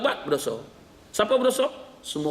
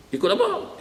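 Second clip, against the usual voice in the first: much higher, startled question; old man baffled by the ustaz's advice, pitched way up; puzzled; slower than usual. Acted. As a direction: leap up in surprise.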